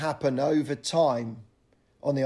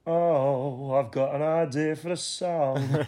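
[name] impression; slower than usual. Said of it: "while [name] went".

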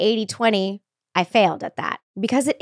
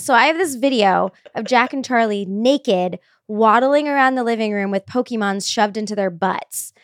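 ordinary delivery

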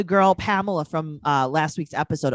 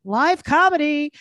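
higher, slow, showy announcer voice; 10 semitones higher, 4 dB louder than usual. Shown as a demonstration. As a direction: sell it like a big show. Repeats the plug in a new voice.

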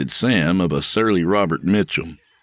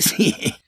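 short jittery laugh; a quick uneasy laugh to cover the tension; nervous laugh; 9 semitones higher than usual. Acted. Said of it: "with a nervous laugh".

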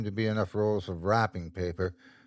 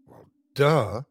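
joking; 2 semitones higher than usual. A narrator's reading. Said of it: "said Christine jokingly".

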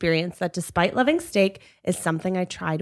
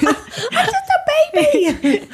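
put-on very high, slow, laughing baby whine; squeaky baby voice; 3 dB louder, much slower than usual. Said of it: "that TikTok".

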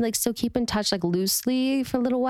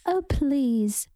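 higher, drawn-out mock accented dismissal; Lisa; 5 semitones higher than usual. Cast member badly imitating castmate, drawn-out accented dismissal. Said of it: "She says".

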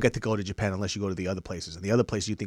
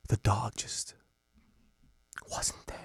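hushed, halting, breathy ham storytelling; over-dramatized testimony; much slower than usual. Retold or imitated. Mocking a man's lost-dog testimony, hammed-up hushed drama. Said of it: "he just keeps dramatizing it".

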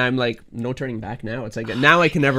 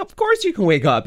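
tender parent voice; 9 semitones higher, 4 dB louder than usual. Retold or imitated. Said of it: "I'm like".

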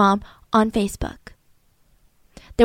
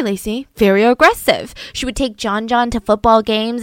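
ordinary talk, nothing marked

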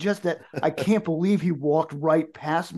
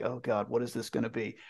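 quiet dread; 4 semitones lower, 9 dB quieter than usual. Retold or imitated. Singer's inner thought voiced.

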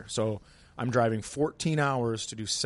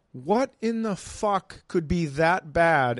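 slow, higher incredulous retort; retold exasperation; exasperated disbelief; 5 semitones higher, slower than usual. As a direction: draw it out in mock bafflement. Comic's exasperated retort to heckler.

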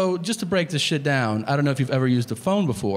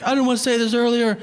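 freaking out; 6 semitones higher than usual. Pretend. Imagined waiter proposing a surf shop, overexcited. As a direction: gush as if overcome by the moment. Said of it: "freaking the fuck out".

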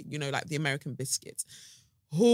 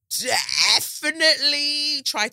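mock outrage; 11 semitones higher, 7 dB louder, slower than usual.

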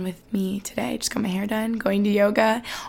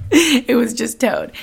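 laughing; 3 semitones higher, 6 dB louder, slower than usual. Real, not acted. Laughing about car being towed.

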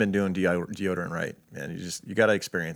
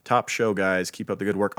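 normal talking voice

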